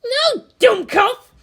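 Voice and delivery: spat back. Acted. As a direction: snap at him in disgust. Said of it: "Asuka spat back".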